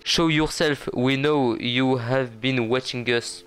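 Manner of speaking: put-on slow English line reading with a French accent. Gamer reads game dialogue to viewers, French-accented English. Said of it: "Je vais le dire en anglais".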